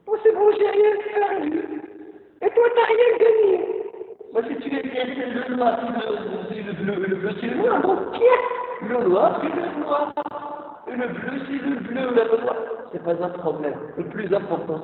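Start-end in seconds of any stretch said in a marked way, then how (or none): 0.1-3.5 much higher and louder, gloating; mock triumphant. Gloating over the other in the argument, high and loud. Voicing the winning side, high. 7.4-10.0 mock insistence. Acted insistence.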